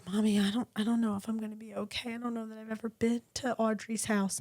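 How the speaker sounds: soft imitation of an anxious little girl; worried child. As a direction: lightly voice a hesitant child, kept quiet. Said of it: "she's very concerned".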